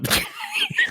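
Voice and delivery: high shriek of laughter. Real, not acted. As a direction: burst out laughing. Cracks up at her own sentence.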